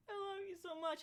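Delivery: high, gushing coo to a dog; retold adoring squeak; tearful affection. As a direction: pour out love in a high voice. Cooing to dog while crying.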